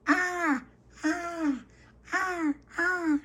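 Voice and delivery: very high cawing crows; squeaky crow cries; crow caws. Retold or imitated. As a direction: caw like distant crows. Imitating crows.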